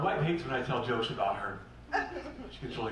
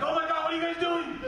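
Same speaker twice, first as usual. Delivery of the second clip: 1 shocked son. Retold or imitated. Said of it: "he's like".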